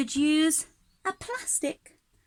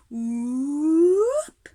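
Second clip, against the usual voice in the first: rising whoop; 5 dB louder than usual. Shown as a demonstration. Teacher makes the growing noise, a rising vocal whoop. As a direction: swoop the voice upward like a plant springing up. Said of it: "my instrument doing that noise".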